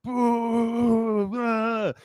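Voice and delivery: long, high drawn-out boo; imitated booing crowd. Conference crowd booing Ricardo, imitated jeer. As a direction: mimic a whole crowd booing the speaker. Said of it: "Everyone starts booing him".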